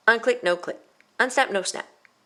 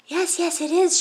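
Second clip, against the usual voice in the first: much higher, small little voice; tiny voice; 12 semitones higher, 5 dB louder than usual. Pretend. Violet playing herself answering the teacher, tiny high voice. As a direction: pitch up into a tiny pretend voice. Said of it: "she would say in a tiny voice".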